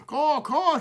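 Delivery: high loud crow caws; crow cry. A narrator's reading. Smokey crying out to the feeding crows, high loud caws. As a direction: cry out like a crow wanting to play. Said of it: "he cried".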